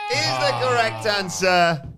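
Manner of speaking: slow, loud, high reveal; game show reveal. Acted. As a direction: announce the winner with a flourish. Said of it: "host reveals the answer".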